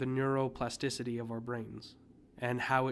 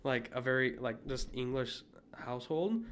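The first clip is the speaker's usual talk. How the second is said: ordinary delivery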